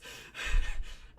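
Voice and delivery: a loud, breathy real laugh; burst of laughter. Real, not acted. Storyteller laughing loudly at her friend swallowing the blunt.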